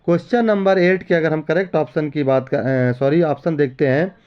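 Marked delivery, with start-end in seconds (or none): none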